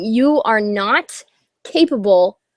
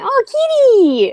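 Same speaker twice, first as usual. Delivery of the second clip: high, drawn-out pet coo; cooing at cat; 5 dB louder, slower than usual. Real, not acted. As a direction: greet the kitty in a slow, high, loud squeal. Spots the cat mid-talk, coos.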